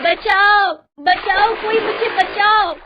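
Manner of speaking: loud, high-pitched pleas for rescue; screaming for help. A narrator's reading. Woman drowning in the pool begs for rescue, screaming. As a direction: shout it out desperately, high and drawn out. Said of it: "चिल्ला रही है".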